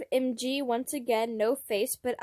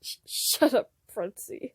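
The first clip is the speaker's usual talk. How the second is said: flustered. Acted. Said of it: "My cheeks flushed".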